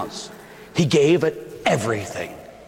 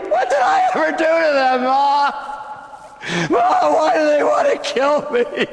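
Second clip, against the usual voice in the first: put-on frightened child crying out; 9 semitones higher than usual. Child Jesus after nightmare.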